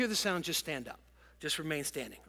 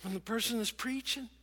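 ordinary talk, nothing marked

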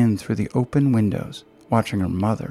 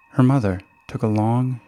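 plain reading voice

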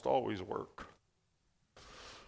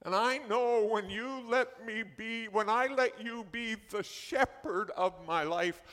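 higher, earnest prayer voice addressing the Lord; praying a psalm; 4 semitones higher than usual. Shown as a demonstration. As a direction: pray it aloud as a model for the congregation. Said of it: "and you say".

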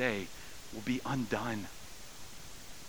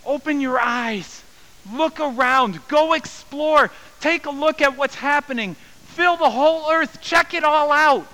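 God's voice calling out; 14 semitones higher, 8 dB louder than usual. Acted. God urging his people to explore, loud joyful call. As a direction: proclaim it like God urging all to look. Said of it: "it's God saying".

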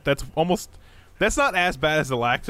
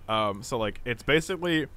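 ordinary talk

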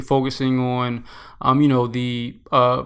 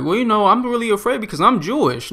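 higher, mock-fretful woman's voice; mock worried woman; 8 semitones higher than usual. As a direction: imitate her fretting, pitched up. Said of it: "one woman said".

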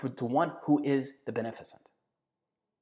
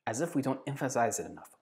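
ordinary talk, nothing marked

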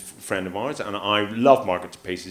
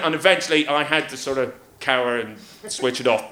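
ordinary talk, nothing marked

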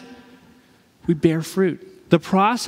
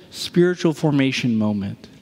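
ordinary delivery

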